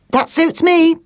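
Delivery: high, piping bird voice; crying starling. Acted. Starling seeing the feather lining, high satisfied cry. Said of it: "cried the starling".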